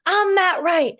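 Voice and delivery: high, loud cocky brag; hammed-up imitation of a partner's brag; mock Matt Wright boast. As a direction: boast like you're the star. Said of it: "He's like".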